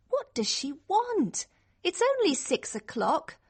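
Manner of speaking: high, plaintive grumble; annoyed complaint. Acted. Girl under the covers at six o'clock, annoyed complaint. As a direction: protest at being woken too early.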